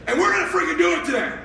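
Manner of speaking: high, slow, laughing insistence; manic pep talk. Retold or imitated. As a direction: hammer the winning line home. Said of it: "I go".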